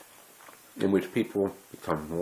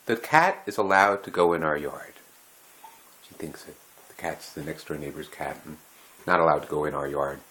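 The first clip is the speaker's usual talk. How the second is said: ordinary delivery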